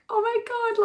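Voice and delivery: high-pitched, thrilled gasp of words; amazed delight. Retold or imitated. Coach recalling getting her client's video, amazed delight. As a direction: relive the happy shock, voice shooting up.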